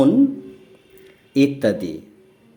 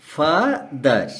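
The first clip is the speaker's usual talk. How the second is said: Father said very slowly in two separate syllables; drawn-out "fa", pause, then "ther" in father; broken into syllables; much slower than usual. Shown as a demonstration. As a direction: split father as "fa... ther", pausing between. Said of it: "ভেঙে উচ্চারণ করি".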